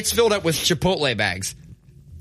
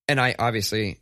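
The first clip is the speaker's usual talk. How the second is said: ordinary delivery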